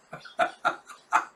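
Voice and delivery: brief breathy chuckle; short laugh. Real, not acted. Reader amused by Yor's reply, breathy laugh.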